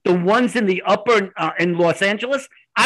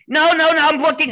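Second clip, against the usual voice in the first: pitched-up, overexcited retold protest; imitated excitement; 9 semitones higher than usual. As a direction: mimic an excited caller cutting in. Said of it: "she's so excited".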